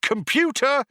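high, loud call to the computer; urgent summoning shout. A narrator's reading. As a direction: shout it out sharply, pitched right up. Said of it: "shouted Zaphod".

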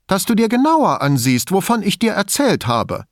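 high-pitched, pressing demand; insistent. A narrator's reading. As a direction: press him to take you seriously. Elisabetta presses Brunetti to investigate.

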